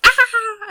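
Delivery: loud, high-pitched shriek of fright; frightened scream. Acted. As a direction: scream in alarm. Perlin screams again.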